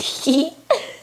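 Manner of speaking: high, squeaky put-on giggle; high mock laugh; acted giggle. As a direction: titter as one of the laughing engines. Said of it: "They all laughed".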